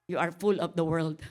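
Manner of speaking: loud, high preaching. Acted. Pastor preaching to the congregation, loud and high. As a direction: preach it with full force.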